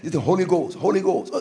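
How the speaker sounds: softly, the words said steadily; a soft, sure mutter of faith; quiet calm confession. Shown as a demonstration. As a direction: say it quietly, like a settled answer. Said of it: "you say".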